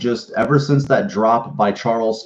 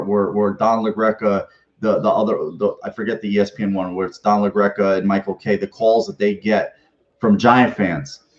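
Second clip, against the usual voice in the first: ordinary delivery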